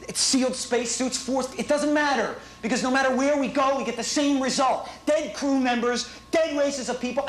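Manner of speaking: high, frantic, whiny rant; trembling whiny yelps. Real, not acted. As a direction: plead like you're scared to die. Panicked rant into tape.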